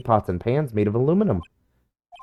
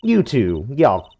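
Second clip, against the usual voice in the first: put-on Southern accent. Southern woman.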